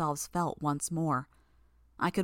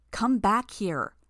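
higher, louder and slow shout; raised voice; 4 semitones higher, 5 dB louder, slower than usual. Acted. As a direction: call her back, dragging each word out. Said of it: "I raised my voice again".

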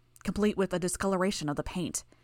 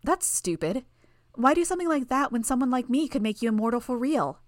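put-on higher, louder bratty little girl; piping girl voice; 6 semitones higher, 4 dB louder than usual. A narrator's reading. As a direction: pipe up like a scornful child. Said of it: "Bonesaw piped up".

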